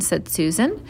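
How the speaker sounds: ordinary delivery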